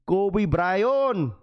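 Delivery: put-on light Filipino accent on Kobe Bryant; Filipino-accented name. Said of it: "That's exactly how they say that shit".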